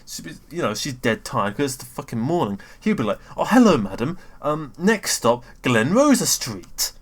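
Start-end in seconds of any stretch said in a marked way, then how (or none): 3.4-6.9 slow, drawn-out cheery stop announcement; imitating a jolly driver announcing the stop; enthusiastic tone. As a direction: stretch it out like a proud bus driver. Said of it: "he'll have that really enthusiastic tone".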